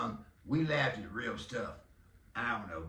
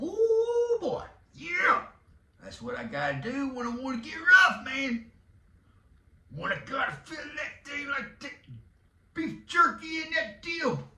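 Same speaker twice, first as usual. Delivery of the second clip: high, loud, strained wrestling-promo voice; Macho Randy; 12 semitones higher, 6 dB louder than usual. Acted. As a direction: shout it like a hyped-up wrestler. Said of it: "Who was that? Racho, Macho".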